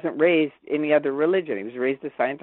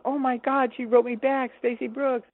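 higher-pitched thrilled replay of getting a reply; retold excitement; 7 semitones higher than usual. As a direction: retell it with hopeful, high-pitched excitement.

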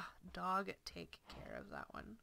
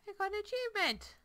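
higher, louder delighted announcement; excited; 11 semitones higher, 7 dB louder than usual. Real, not acted. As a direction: gush over the achievement. Excited at achievement.

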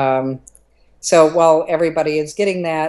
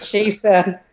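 ordinary delivery

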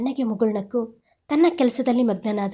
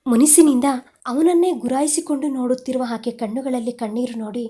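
plain reading voice

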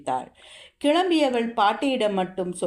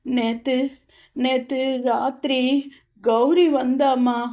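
higher and a bit louder, acted fear; trembling; 6 semitones higher, 3 dB louder than usual. A narrator's reading. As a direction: stammer it out as if shaken. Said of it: "நடுங்கியபடியே கூறினாள்". (நடுங்கும் குரலில்)

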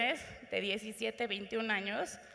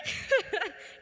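a quick real laugh, pitched up; short laugh; 8 semitones higher, 4 dB louder than usual. Real, not acted. Audience speaker breaks into a short laugh. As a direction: laugh at the thought of a stranger saying no. Laughs at her own remark.

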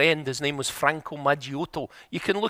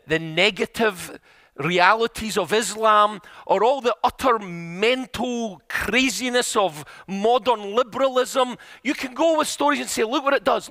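raised pitch, climbing as the list builds; impassioned preaching; 5 semitones higher than usual. Retold or imitated. Friend warns against attacking with scandals, in impassioned preaching voice. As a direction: preach it with mounting intensity.

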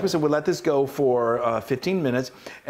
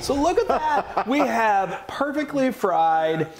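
excited; 9 semitones higher, slower than usual. Real, not acted. Showing off the finished dish.

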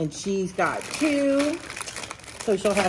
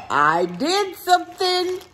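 excited; 10 semitones higher than usual. Real, not acted. Teasing the big reveal.

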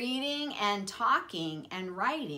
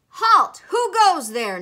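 high, very loud challenge; shouting sentry; 9 semitones higher, 12 dB louder than usual. A narrator's reading. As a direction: call out like a guard stopping an intruder. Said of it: "Neptune shouted".

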